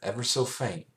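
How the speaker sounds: ordinary delivery, nothing marked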